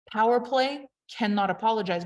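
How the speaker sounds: ordinary talk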